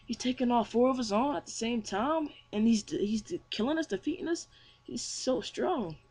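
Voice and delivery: high-pitched amazed disbelief; mock astonishment. Stunned fighter watching one foe beat four, astonished. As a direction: act out being stunned, voice lifted. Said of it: "Gray's astounded".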